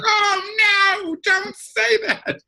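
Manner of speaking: very high, drawn-out whine of protest; mother's mock wail. Mother begging her teasing son to stop, high mock wail. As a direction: wail it like a comically wounded mother. Mother's distressed reply, acted out.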